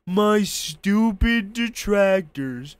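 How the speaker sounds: slow, lightly voiced streamer whine; mock the streamer's complaint; a hint of an aggrieved streamer; imitating Phil. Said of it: "he's gonna be like".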